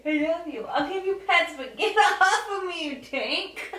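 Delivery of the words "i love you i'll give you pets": ordinary talk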